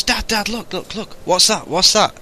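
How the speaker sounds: put-on high, insistent child's voice; excited little boy. Little boy at the zoo calls his dad to look, high excited child voice. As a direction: pester Dad in a high, eager voice.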